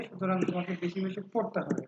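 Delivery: usual speaking voice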